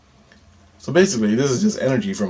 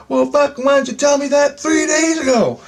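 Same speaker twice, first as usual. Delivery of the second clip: very high, loud yell; exasperated outburst; 7 dB louder than usual. Retold or imitated. Asking why she waited three days, exasperated high yell. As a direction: yell it at the top of your pitch, hammed-up outrage. Retold outburst at late explanation.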